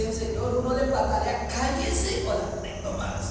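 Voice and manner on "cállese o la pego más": stern scolding mother. Pretend.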